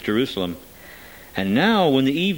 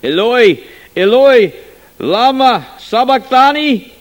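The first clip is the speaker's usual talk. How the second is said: high, loud, slow anguished cry; 12 semitones higher, 10 dB louder, slower than usual. A narrator's reading. Dying man crying out to God, high and loud. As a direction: cry out to the heavens. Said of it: "cried with a loud voice".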